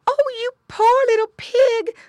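high, loud pitying cry; mother pig. A narrator's reading. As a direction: exclaim over your hurt piglet. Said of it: "grunted Missus Pig".

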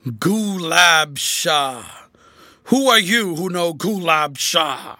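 loud, slow, shocked cry; gasped out. A narrator's reading. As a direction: drag the words out in dread. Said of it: "At last he gasped out".

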